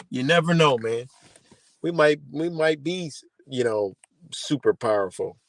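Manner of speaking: ordinary talk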